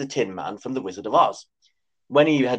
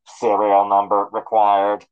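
loud, slow machine demand; stern robot voice; 3 semitones lower, 6 dB louder, slower than usual. A narrator's reading. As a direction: clip it out like a guard robot. Sentinel demanding serial.

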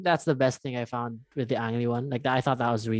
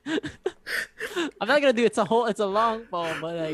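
high-pitched, laughing through the words; breaking into giggles while talking; real laughing; 9 semitones higher than usual. Real, not acted. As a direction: give up on it with a laugh. Breaks off poem recital laughing.

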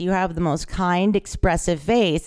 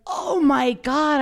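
drawn-out, higher-pitched amazed recall; retold sudden realization; 7 semitones higher, much slower than usual. Interviewer recalls recognising the film's true story, amazed realization. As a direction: re-enact it dawning on you, long and high.

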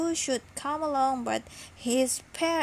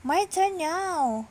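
higher, eager whoop; excited whoop; 6 semitones higher, 4 dB louder than usual. A narrator's reading. Jock claiming his turn at the bone, high whoop. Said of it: "whooped Jock".

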